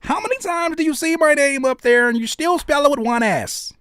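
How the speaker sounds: high-pitched, hammed-up indignant complaint; mock outrage. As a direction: wail it in exaggerated exasperation. Mock outrage over misspelled name.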